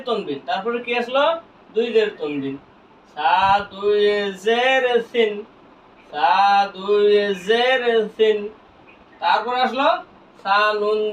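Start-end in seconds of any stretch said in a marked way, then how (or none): 3.1-8.4 slow, stretched syllables; measured spelling drill for learners; drawn-out spelling. Shown as a demonstration. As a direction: hold জের long before সিন. Spelling drill recited twice.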